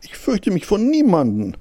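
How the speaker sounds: high-pitched show of bravery; self-encouraging. A narrator's reading. Hare reassuring himself he fears nobody, high brave voice. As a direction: talk yourself into courage, pitched high. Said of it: "machte er sich selbst Mut".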